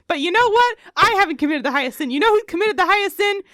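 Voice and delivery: high, shouted comic tirade; loud, aggrieved outburst with real bite; spiteful rant. Acted. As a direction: build to a hammed-up screech of grievance. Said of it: "There's some real spite behind here".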